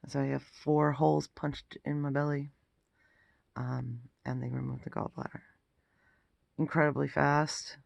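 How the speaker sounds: normal talking voice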